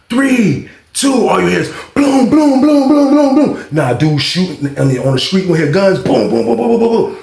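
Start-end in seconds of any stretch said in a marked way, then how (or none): 1.3-3.7 imitated gunshots. 6.0-7.2 imitated gunshots.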